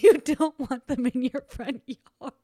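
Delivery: talking through laughter, slowing down; laughing. Real, not acted. Telling listeners about ruined gardens, laughing. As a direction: laugh your way through the words. Cracking up at own remark.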